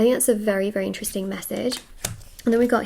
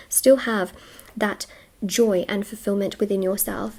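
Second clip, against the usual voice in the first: ordinary talk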